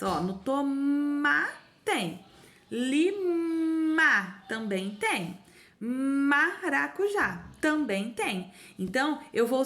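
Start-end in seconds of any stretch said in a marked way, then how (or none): 0.0-8.5 slow, stretching the "ma" in each word; teacher drawing out "toma", "Lima", "Maracujá"; slow emphatic reading. Shown as a demonstration. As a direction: point out the shared syllable slowly. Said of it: "Tem o ma em todas elas?".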